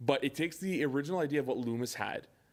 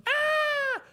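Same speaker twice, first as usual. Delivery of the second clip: a high mock scream, much higher and louder; mock horror scream; 7 dB louder than usual. Mocking gory horror films with a mock scream. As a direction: shriek like a cheap slasher victim.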